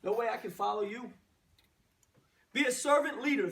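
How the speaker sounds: usual speaking voice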